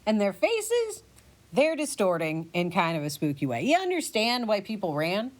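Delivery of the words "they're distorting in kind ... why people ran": ordinary talk, nothing marked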